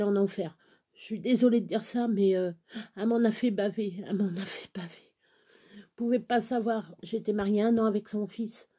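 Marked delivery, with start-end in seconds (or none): 4.1-5.1 reprise chuchotée, plus douce; chuchoté. Real, not acted. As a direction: chuchote la même phrase, presque pour soi. Répète la phrase deux fois. (répétition chuchotée)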